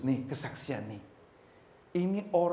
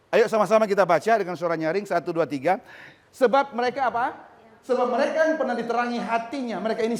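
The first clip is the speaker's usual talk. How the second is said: leading a reading; 7 semitones higher, 7 dB louder than usual. Acted.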